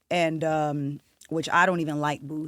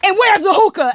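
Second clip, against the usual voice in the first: screaming demand; 12 semitones higher, 10 dB louder than usual. Retold or imitated. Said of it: "You still screaming for this damn hookah".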